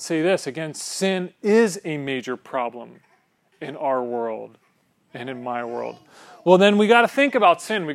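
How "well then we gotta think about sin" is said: emphatic. Real, not acted. Preacher emphasis.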